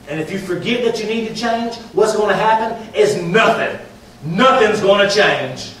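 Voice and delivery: forceful sermon climax, words stretched; emphatic preaching. Real, not acted.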